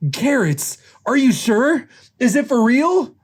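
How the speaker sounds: high, eager questioning; thrilled disbelief. Acted. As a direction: ask in rising, high-pitched amazement. Nick stunned by pregnancy news.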